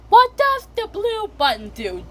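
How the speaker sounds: high-pitched, curious Peppa line; high, eager question; high child voice. Acted. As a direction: ask it in a squeaky little voice. Peppa curious about car buttons.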